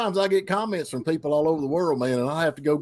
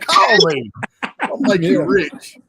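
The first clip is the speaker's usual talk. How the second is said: slow, loud, higher TV lawyer pitch; 4 semitones higher, 6 dB louder, much slower than usual. Pretend. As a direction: sell it like a cheesy injury lawyer. Mock injury lawyer ad.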